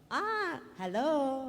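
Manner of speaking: very slow, timid greeting from the background; hesitant drawn-out hello; shy little hello. Retold or imitated. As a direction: imitate herself waving meekly from the back. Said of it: "Gaganon lang ako".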